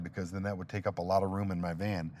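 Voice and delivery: ordinary delivery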